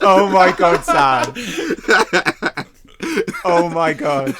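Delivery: loud, laughing disbelief. Real, not acted. Reacting to Sam's joke, laughing.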